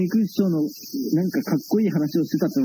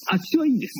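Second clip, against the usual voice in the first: high-pitched catchphrase impression; 8 semitones higher than usual. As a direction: lightly mimic a colleague's higher-pitched polite refusal. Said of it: "彦丸兄さんは".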